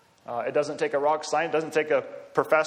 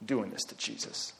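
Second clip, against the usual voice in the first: hushed, sneering mockery, then quicker; a Pharisee mocking the woman under his breath; 6 dB quieter than usual. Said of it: "the kind of voice of mockery".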